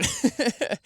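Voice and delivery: short, high-pitched burst of giggles; real laugh. Real, not acted. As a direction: laugh at yourself, pitched well up. Laughs recalling crying on trail.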